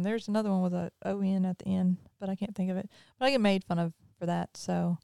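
ordinary talk

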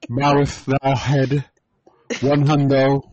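booming biblical narration. Pretend. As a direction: bless them like an old preacher. Mock biblical prayer.

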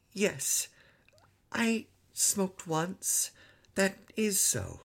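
put-on high, whispered little-boy admission; timid confession. A narrator's reading. Son admits smoking to father, timid whisper. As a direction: confess it small and slow.

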